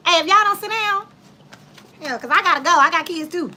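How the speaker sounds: scolding the class. Retold or imitated. Substitute warning the rowdy class, loud scolding.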